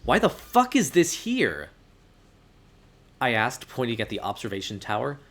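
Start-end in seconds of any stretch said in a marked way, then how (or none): 0.0-1.7 loud, high, slow outrage; outraged. Acted. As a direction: spit it out in outrage. Outraged at the tower.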